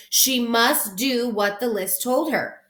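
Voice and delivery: ordinary delivery, nothing marked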